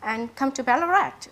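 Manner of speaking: a hint of hopeful resolve; lightly voiced family decision, rising; retold decision. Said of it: "we said".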